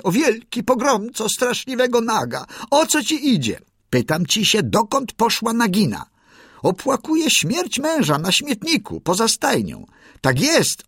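high, eager bird voice singing praise; proud songbird crowing the victory; excited little bird. A narrator's reading.

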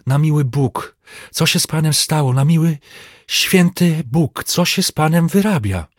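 high, wailing plea; tearful lament. A narrator's reading. Servant falling at his master's feet, tearful lament. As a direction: plead in distress, drawing out the holy oath. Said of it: "z ogromnym płaczem runął mu do nóg".

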